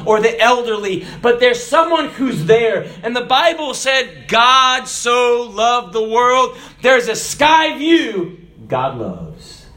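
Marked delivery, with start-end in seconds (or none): 4.3-8.4 drawn-out, high declaration; preacher proclaiming God's love; slow emphatic preaching. Acted. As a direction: let each word land slowly.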